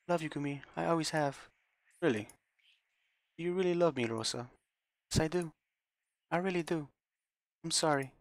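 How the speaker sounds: put-on cling to him like a little child; a lightly voiced broken girl; childlike girl. Rosa clinging to Gumi.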